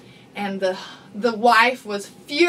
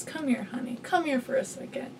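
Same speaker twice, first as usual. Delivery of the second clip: soothing wife; 13 dB quieter than usual. Retold or imitated. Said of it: "And the wife walks over and says".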